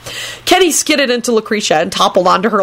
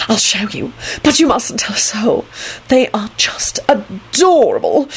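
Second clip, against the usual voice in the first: confiding, turning slow and breathy; loud stage whisper; 4 semitones higher than usual. A narrator's reading. Antonin sharing a secret with Neville, whispering. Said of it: "he whispered loudly".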